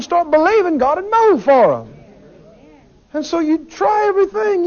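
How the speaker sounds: high, loud, impassioned preacher's build; a fervent preacher pleading from the pulpit; full-force preaching. Acted. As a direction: preach it out to the whole room.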